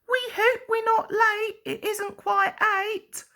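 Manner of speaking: high, fluttery worried voices; anxious twitter. A narrator's reading. Annie and Clarabel worry about being late, high anxious twitter. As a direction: twitter it fast and fretful, pitched high. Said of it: "twittered anxiously".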